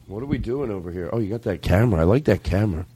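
normal talking voice

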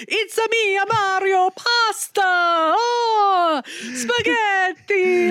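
high, drawn-out mock Italian; over-the-top Mario-style Italian accent; hammy Italian accent. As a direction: draw out each word in a cartoon Italian accent. Mocking the film's Italian accent as Mario.

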